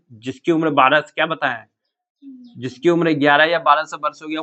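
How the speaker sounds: usual speaking voice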